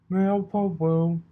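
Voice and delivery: high, loud, daffy mail pony voice; cross-eyed mail mare. A narrator's reading. Mail mare hands Rarity her mail, high and goofy. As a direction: chirp it out like a goofy mail carrier. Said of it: "said the cross-eyed mail mare".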